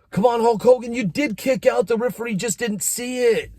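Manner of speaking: high, pleading fan voice; a fan begging Hulk Hogan to have kicked out. Retold or imitated. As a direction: beg the TV for your hero. Pleading with hero on tape.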